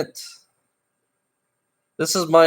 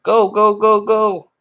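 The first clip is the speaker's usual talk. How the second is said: higher, louder go go go; shooing away; 10 semitones higher, 3 dB louder than usual. Real, not acted. Shooing viewers out of his livestream, loud and high. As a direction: shoo them out of the room.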